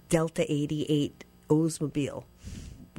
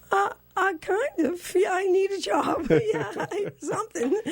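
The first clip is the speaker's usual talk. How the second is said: flustered; 14 semitones higher, 3 dB louder than usual. Retold or imitated. Flustered reply to job offer.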